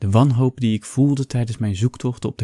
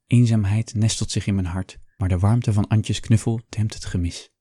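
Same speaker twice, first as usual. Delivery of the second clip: ordinary delivery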